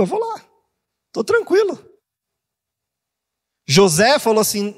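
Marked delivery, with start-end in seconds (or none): 0.0-1.8 carefree high voice. Retold or imitated. David talking facing the giant.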